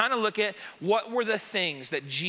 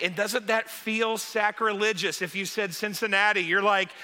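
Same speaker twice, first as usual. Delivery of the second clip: ordinary delivery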